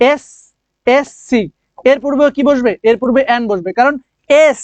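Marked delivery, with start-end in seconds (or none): 0.1-1.4 "এসএসসি" drawn out very slowly, letter by letter; slow spelled-out SSC. Shown as a demonstration. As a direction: stretch "এসএসসি" so each letter sounds. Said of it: "এই যে এসএসসি".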